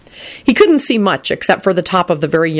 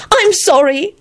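high, sudden apology; blurted; 14 semitones higher, slower than usual. A narrator's reading. Elizabeth apologising to Lupin, high sudden blurt. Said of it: "Elizabeth blurted out".

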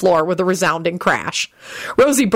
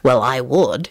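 low, short-tempered answer; short reply; 5 semitones lower, slower than usual. A narrator's reading. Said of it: "he replied shortly".

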